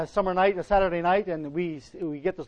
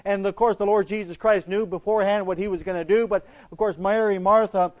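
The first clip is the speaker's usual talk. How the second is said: usual speaking voice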